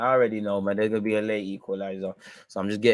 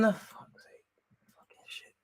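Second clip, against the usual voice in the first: whispered curse; 12 semitones higher, 10 dB quieter, slower than usual. Real, not acted. Swears under breath after Rafinha.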